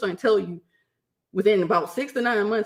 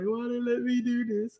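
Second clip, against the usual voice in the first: higher-pitched comic whimper; mock crying; 5 semitones higher, 4 dB quieter than usual. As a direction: whine it as if in tears, played for laughs. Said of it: "I started crying".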